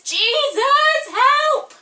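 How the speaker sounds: high, loud desperate cry; calling for help. Acted. Sinking Peter crying out to Jesus, loud high plea. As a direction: yell for help while sinking. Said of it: "he calls out".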